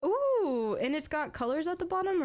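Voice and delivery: light up at a new skin; excited ooh. Real, not acted. Streamer spotting a shop skin, excited ooh.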